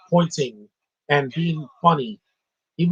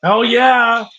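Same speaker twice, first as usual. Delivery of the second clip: higher, louder whoop; excited cheer; 7 semitones higher, 7 dB louder than usual. Real, not acted. Cheering the Batman reference in a higher, louder whoop.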